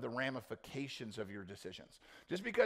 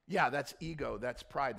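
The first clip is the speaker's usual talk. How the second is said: ordinary delivery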